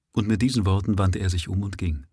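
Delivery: ordinary delivery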